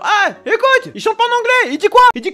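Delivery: high, loud, excited exclamation; party show-off. Acted. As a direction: call out to friends to make them listen. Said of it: "En soirée, vous allez pouvoir faire vos intéressants".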